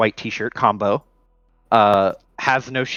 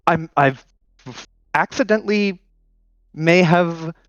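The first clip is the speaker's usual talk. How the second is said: nervous Hijack; 5 semitones higher than usual. Acted. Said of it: "anxious and, like, excited somehow".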